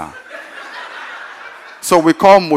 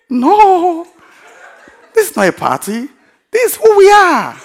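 high-pitched retold protest, loud at the peaks; proudly overblown, swooping up high; exuberant correction; 6 dB louder than usual. Preacher telling the guest it is no party, exuberant correction.

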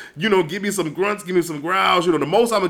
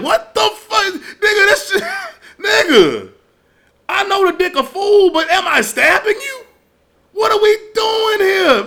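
higher, laughing, incredulous outburst; alarmed disbelief; 14 semitones higher, 4 dB louder than usual. Retold or imitated. Retelling his reaction to a partner's noises, laughing disbelief. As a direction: react like something's gone horribly wrong.

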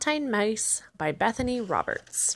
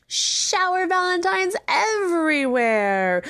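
excited; 5 semitones higher than usual. A narrator's reading. Joyful throwing of valentines.

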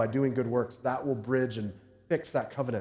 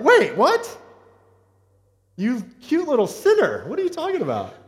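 much higher and louder, hammed-up disbelief; mock shock; 9 semitones higher, 6 dB louder than usual. Dad reacting to his daughter's confession, hammed-up shock, higher and louder. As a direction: play the shocked dad, over the top. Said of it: "And I was like".

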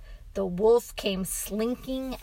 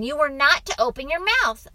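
raised, quick and loud; scolding father; 6 semitones higher, 8 dB louder, faster than usual. A narrator's reading. Father reminds son of warning, scolding. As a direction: reprimand the child firmly.

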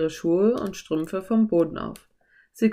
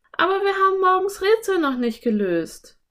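put-on very high girl character voice; Anne; 13 semitones higher, 4 dB louder than usual. A narrator's reading. Anne reminds Philipp of the riddle, high objecting voice.

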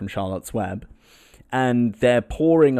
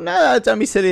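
high, loud friendly protest; dismissive coaxing; 13 semitones higher, 6 dB louder than usual. Acted. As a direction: wave the refusal away up high. Friends voiced, brushing off his refusal.

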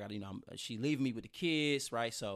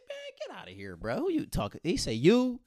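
higher mock-incredulous buddy voice; friend's disbelief; 10 semitones higher than usual. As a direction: scoff like a stunned friend.

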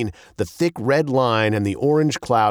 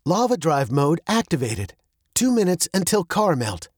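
lightly voiced car computer, raised pitch; droning car computer; 7 semitones higher than usual. A narrator's reading. Car announces mode and countdown, lightly droning computer. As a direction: announce it like an onboard system. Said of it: "the car droned".

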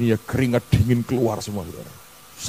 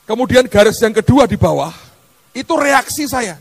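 preaching at full force; 11 semitones higher, 5 dB louder than usual. Acted.

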